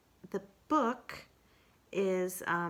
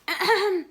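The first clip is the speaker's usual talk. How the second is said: loud, high little throat clear; 13 semitones higher, 10 dB louder than usual. Acted. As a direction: clear your throat before reciting. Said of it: "Pooh gave a little cough".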